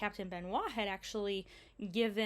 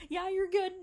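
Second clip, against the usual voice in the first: laughing quote; 11 semitones higher, 5 dB louder than usual. Retold or imitated. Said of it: "And he goes".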